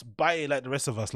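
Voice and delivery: normal talking voice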